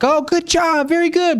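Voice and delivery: high, gushing puppy praise; overexcited cooing at the puppy. Retold or imitated. Trainer mocking over-the-top praise for the puppy, high and gushing. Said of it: "you don't wanna, like".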